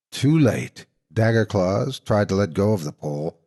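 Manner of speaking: ordinary delivery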